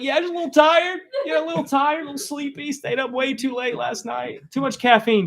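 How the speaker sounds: a scared boy pretending he's just sleepy; nervous kid excuse. Boy covering his fear from his dad, high nervous excuse.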